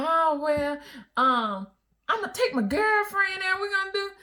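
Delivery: breezy and unaware; husband's answer. Retold or imitated. Wife imitates husband mentioning his girlfriend, sing-song.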